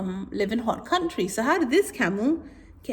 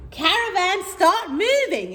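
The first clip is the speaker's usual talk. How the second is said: calling leader; 10 semitones higher, 4 dB louder than usual. Acted. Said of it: "The leader said".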